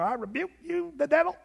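lightly hammed-up wobbly preacher rebuke; quivering evangelist. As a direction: put a mock quiver in the rebuke. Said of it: "you gotta have the quiver in your voice".

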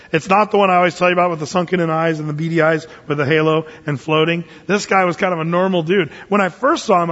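normal talking voice

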